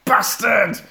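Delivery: high screaming killer doll; Chucky scream. Retold or imitated. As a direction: shriek it like a possessed doll. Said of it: "goes full fucking Brad Chucky".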